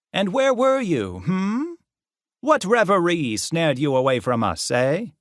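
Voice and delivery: high, teasing interrogation; hammed-up sing-song scolding; mocking professor. A narrator's reading. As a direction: toy with the caught student.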